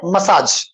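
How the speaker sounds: मसाज stressed on a long "saaj"; मसाज said "muh-SAAJ"; lengthened ending. Shown as a demonstration. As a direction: draw out the second syllable of मसाज. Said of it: "एज खींचेंगे".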